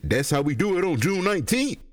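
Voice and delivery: hammed-up quote of a cheesy film line; mocking a movie line. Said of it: "this bitch said".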